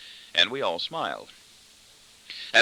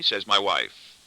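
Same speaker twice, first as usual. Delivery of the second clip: ordinary delivery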